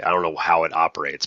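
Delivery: usual speaking voice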